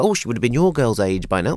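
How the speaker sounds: ordinary talk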